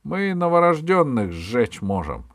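высокая громкая угроза; грози расправой, не уступая; епископ-обвинитель. A narrator's reading. (громко и высоко угрожая)

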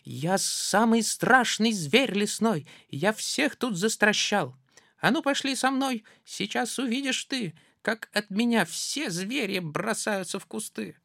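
high, proud mouse voice; boastful mouse. Acted. Mouse boasting to the gruffalo, high and proud. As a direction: puff himself up.

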